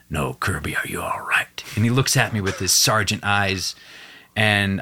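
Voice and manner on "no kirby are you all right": lower, softer whispered question. Retold or imitated. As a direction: lean in and whisper it, insisting. Sergeant grabs his shoulders.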